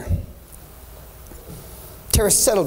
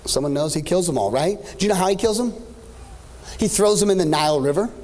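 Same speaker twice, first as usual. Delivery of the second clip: ordinary talk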